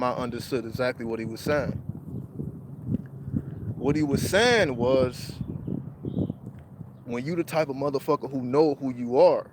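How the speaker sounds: ordinary delivery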